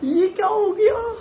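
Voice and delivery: high-pitched, hammed-up wail of dismay; mock wailing. Husband seeing his tiffin, mouth watering, mock wailing. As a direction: wail in pretend distress, laughter close behind.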